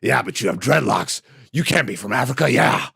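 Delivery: lightly voiced bossy promoter, building to a shout; hammed-up boss ordering him around; mock boss demanding a Jamaican accent, ending in a yell; mock wrestling boss. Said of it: "That is such a pitch perfect fucking".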